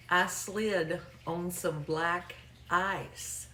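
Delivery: very slow, word by word; saying it slow. Retold or imitated. Caller correcting the agent's mishearing, very slow. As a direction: spell it out slowly, stretching each word. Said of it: "Let me say it slow".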